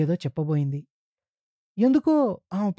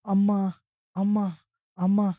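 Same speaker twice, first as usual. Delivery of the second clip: pained calls, raised in pitch; cry of pain; 4 semitones higher than usual. A narrator's reading. Man in pain calls for his mother, pained cry. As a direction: call out Amma as if hurting. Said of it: "బాధతో అరిచారు".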